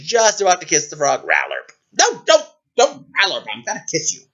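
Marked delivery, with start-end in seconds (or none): 1.3-1.7 low-pitched frog croak; sudden deep ribbit-like call. Acted. Frog croaks before kiss. 1.9-4.2 high, flustered scolding; exasperated princess. Acted. Princess scolds croaking frog.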